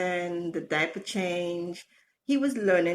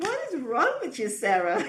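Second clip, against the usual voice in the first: laughing self-scold; 6 semitones higher than usual. Retold or imitated. Scolding herself while laughing.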